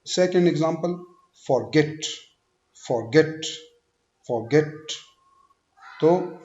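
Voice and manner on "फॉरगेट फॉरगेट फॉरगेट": Forget with light फॉर and strong गेट; short फॉर, hit गेट; second-syllable stress. Shown as a demonstration. Teacher drilling word stress, forget stressed on गेट. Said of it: "second syllable पे stress देना है".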